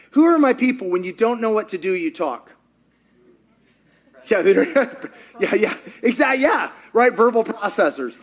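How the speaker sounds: ordinary talk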